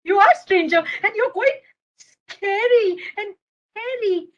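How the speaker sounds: acted alarmed child; frightened little boy. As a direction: fend off a scary stranger.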